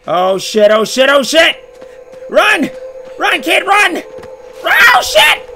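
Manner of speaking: high, loud frantic shouts; panicked yelling. Real, not acted. Player screams at his character to run, high and loud.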